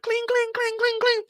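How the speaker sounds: very high metallic clings; shell clinks. Retold or imitated. Brian reloading, very high metallic clings. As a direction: ring like casings on concrete.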